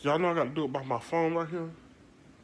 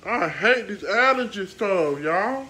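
louder, slower, fed up with allergies; frustrated complaint; 4 semitones higher, 8 dB louder, slower than usual. Real, not acted. Complaining to viewers about allergies, fed up. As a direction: drag it out, exasperated.

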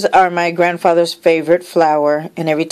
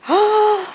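high gasp of surprise; sharp, high intake of breath; surprised gasp; 14 semitones higher than usual. Real, not acted. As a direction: gasp at an unexpected gift. Gasp on seeing the gift.

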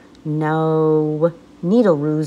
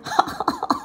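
very high burst of laughter; loud laugh. Acted. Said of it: "laughed out loud".

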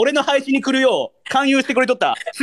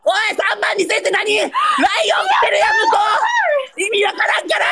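frantic shouting; 9 semitones higher than usual. Real, not acted. Streamer shouting at a rival's viewers' huge gift, frantic high yell. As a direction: yell in disbelief as the gifts pile up. Gifts flooding in at the end.

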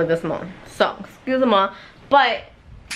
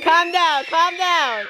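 ordinary delivery

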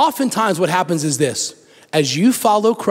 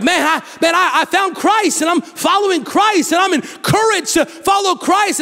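excited new believer; 9 semitones higher than usual. Retold or imitated. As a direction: burst out with joy about your faith. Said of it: "you're like".

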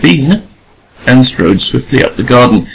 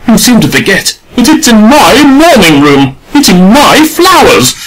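high, louder raging retort; 9 semitones higher, 4 dB louder than usual. A narrator's reading. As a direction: rage back at the artist. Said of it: "came the raging retort".

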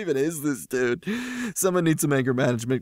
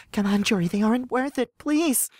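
higher voice, rising toward the final word; lightly voiced pleading girlfriend; 8 semitones higher than usual. Girlfriend urging Jory to drop the fight, lightly acted plea. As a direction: plead gently to pull someone back. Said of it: "Blaze grabs my shoulder".

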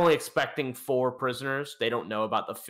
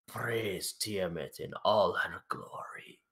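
slow whispered praise, half joking; sarcastic sincere whisper; 4 semitones lower, 5 dB quieter, slower than usual. Acted. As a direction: whisper the praise, mocking yet devout. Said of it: "in the most sarcastic but sincere".

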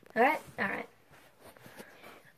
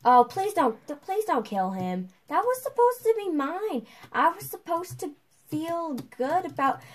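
high loud pleading; pleading whine; 8 semitones higher, 3 dB louder than usual. Real, not acted. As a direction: beg them to stop. Begging not to steal kill.

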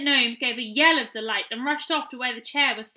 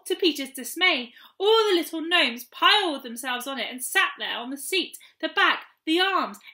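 plain reading voice

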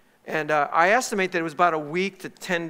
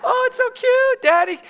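high, loud kiddie squeal; mock a child's pleading in a shrill falsetto; imitating excited little daughters; 7 dB louder than usual. Two daughters begging Daddy to keep the kitten, high excited squeal. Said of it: "First words came out of their mouth".

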